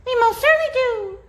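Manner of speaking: very high, small and chirpy; high little piping yes; piping tiny voice. A narrator's reading. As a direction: pipe it up like a tiny mayor. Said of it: "piped the voice".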